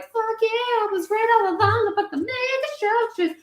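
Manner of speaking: high, excited mock voice. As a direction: squeal it like a gleeful fanboy. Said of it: "Game of Thrones British guy is like".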